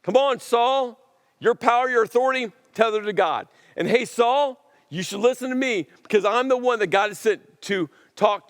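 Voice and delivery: high-pitched, insistent prophet's appeal; emphatic, lightly acted messenger of God; Samuel. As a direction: urge the king to listen, voice pitched well up. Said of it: "Samuel says to Paul".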